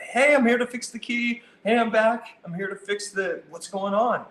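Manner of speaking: high, eager hello at the door; energetic, happy arrival at the customer's door; excited greeting. Shown as a demonstration. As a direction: burst in glad to be back. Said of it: "It's that energy".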